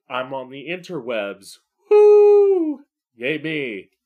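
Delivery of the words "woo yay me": excited cheer. Real, not acted. Celebrating being on the internet.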